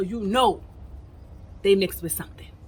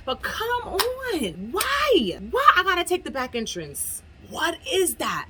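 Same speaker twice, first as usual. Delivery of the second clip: higher, exasperated questions; higher indignant questions about the back entrance; indignant disbelief; 8 semitones higher, slower than usual. Real, not acted. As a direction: demand an answer, exasperated.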